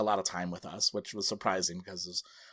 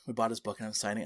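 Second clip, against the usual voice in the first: ordinary delivery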